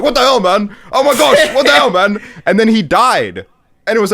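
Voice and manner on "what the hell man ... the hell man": panicked yelling. Retold or imitated. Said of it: "he was just honestly like".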